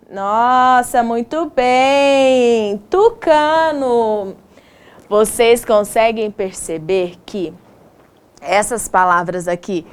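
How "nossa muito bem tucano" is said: slow, stretched-out teacherly approval; drawn-out praise. Shown as a demonstration. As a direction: praise the class with long held syllables. Teacher praising, repeating word.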